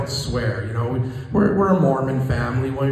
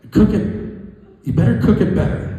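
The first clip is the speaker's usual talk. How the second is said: wife's comeback; 7 semitones higher, 3 dB louder than usual. Retold or imitated.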